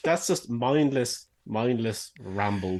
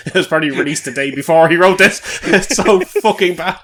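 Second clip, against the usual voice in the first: high, loud, laughing outburst; laughing in disbelief; 7 semitones higher, 14 dB louder than usual. Real, not acted. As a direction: crack up while saying it. Cracking up at bad lyrics.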